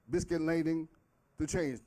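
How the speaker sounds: ordinary delivery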